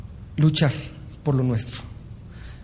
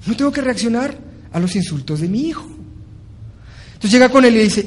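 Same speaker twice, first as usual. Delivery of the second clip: ordinary talk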